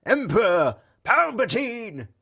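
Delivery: high, drawn-out hammed-up chant; mock yelling. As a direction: imitate silly troops yelling for their ruler. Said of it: "There was no one yelling".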